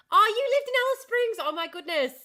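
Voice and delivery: loud, high-pitched burst of excitement; high, loud and thrilled; excited surprise. Real, not acted. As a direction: exclaim it in real, delighted surprise. Delighted at a viewer's shared hometown.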